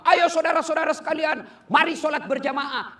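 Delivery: calling out to the congregation; preaching call. Retold or imitated. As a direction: urge the crowd loudly to pray. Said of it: "Saya ceramah".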